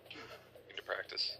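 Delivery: whisper. Real, not acted. Teasing aside to a player.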